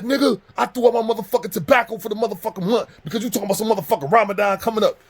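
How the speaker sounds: high, loud outraged rant; furious outburst. Retold or imitated. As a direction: yell it as a man who feels cheated. Friend's furious outburst acted out.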